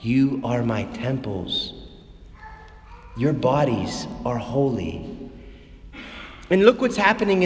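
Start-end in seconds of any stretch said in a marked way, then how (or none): 0.0-5.1 voice of the Father. Retold or imitated. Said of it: "Father's talking to you right now".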